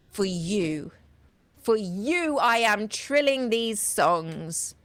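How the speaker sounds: rising, declaiming poetry read with slow stretches; poem reading. Acted. Reading Whitman's poem to the audience, grandly declaimed. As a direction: proclaim each line like an anthem.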